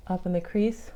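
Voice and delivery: usual speaking voice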